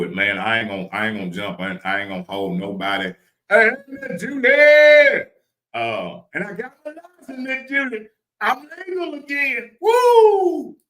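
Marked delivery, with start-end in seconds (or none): 3.5-10.8 high, loud, jubilant whoop; excited holler. Real, not acted. Driver shouting out to Miss Julie about the license, excited holler. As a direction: holler it out in celebration.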